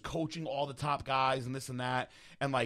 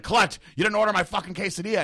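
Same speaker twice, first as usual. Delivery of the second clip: loud, raised mock tirade; imitated angry accusation; 7 semitones higher, 10 dB louder than usual. Man blaming a woman for his missing order, angry. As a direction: blame her at full volume, acted. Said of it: "like, mad".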